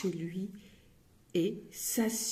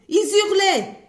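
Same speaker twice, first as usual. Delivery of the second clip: higher and louder, almost yelling; loud exclaim; 11 semitones higher, 11 dB louder than usual. A narrator's reading. Narrator yelling to show how Bretons shout. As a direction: belt the word out. People who yell.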